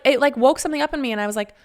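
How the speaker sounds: normal talking voice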